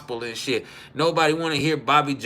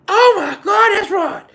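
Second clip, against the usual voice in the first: very high, loud oh my God; much higher, louder thrilled cry; excited relative; 10 dB louder than usual. Retold or imitated. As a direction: gush like a tipsy aunt. Relative greeting him.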